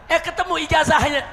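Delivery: high, loud, slowing on the final words; loud scornful punchline. Real, not acted. Preacher exposing the official's diploma to congregation, loud scorn. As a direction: throw the insult back at full volume. Preacher gleefully turns insult back.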